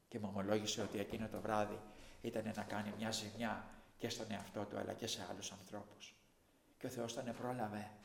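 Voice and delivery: ordinary delivery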